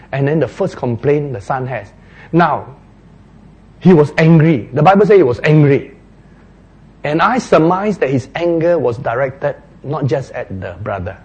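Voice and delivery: ordinary delivery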